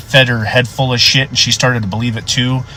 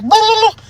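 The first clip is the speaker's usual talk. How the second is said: very high gibbering noise; frantic babble. Retold or imitated. Deputy overwhelmed by the old man's panic, high frantic babble. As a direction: let out a high-pitched flustered gibber.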